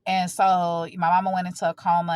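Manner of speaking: normal talking voice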